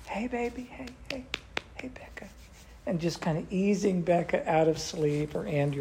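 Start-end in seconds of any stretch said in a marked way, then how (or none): 0.1-2.3 high, soft coaxing that fades to a whisper; imitating a mother's tender wake-up call; sweet waking voice. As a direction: gently ease a sleeping child awake. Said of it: "pat them in this sweet Batesville accent and voice".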